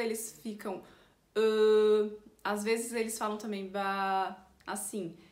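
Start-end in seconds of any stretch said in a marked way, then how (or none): 1.3-2.1 long, loud, level French "euh"; thinking hesitation. Shown as a demonstration. Teacher shows French people thinking, drawn-out hesitation. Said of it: "pra mostrar que eles estão pensando". 3.7-4.4 "Bah" held long on a level tone; drawn-out hesitation. Shown as a demonstration. Teacher shows French hesitation, "Bah" drawn out. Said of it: "bem, é, demoradinho".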